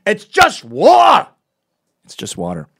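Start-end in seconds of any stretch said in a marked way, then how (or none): 0.1-1.3 very loud, high, drawn-out Glaswegian; Glasgow accent. Retold or imitated. Glasgow local scolding a man about rain, bellowed Glaswegian. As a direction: bellow it slowly like a mock-outraged Glaswegian. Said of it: "the most gentle it gets in Glasgow".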